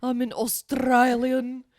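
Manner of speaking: loud slow mock Australian accent. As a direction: proudly announce it in broad Aussie. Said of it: "talk like this".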